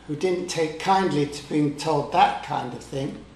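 usual speaking voice

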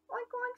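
very high playful oinks; mock pig oinks. Pigs answer the duck, high oinks. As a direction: oink like two piglets.

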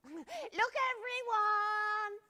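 high, loud slow call out; cried. Acted. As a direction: call the whole room to look. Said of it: "cried Danny".

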